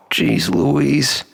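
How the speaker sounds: usual speaking voice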